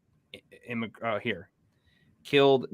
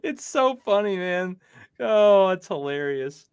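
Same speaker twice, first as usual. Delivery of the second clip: laughing; 6 semitones higher, slower than usual. Real, not acted. Still laughing at the video.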